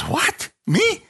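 startled disbelief, leaping very high; Moses. Acted. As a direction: squeak it out in comic astonishment. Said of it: "I felt like Moses".

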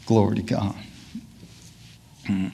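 ordinary talk, nothing marked

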